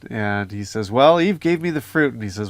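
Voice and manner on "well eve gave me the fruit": higher, louder lightly acted excuse; mock excuse-making. Adam blames his wife to God, mock defensive. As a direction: pass the blame in a retold protest. Retold Adam blaming Eve.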